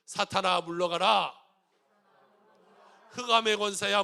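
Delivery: loud, slow commanding shout; booming call to drive Satan out; shouted declaration. Shown as a demonstration. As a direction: proclaim it with full force for all to repeat. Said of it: "한번 따라서 한번 외쳐보세요".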